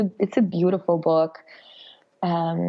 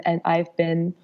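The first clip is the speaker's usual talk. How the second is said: usual speaking voice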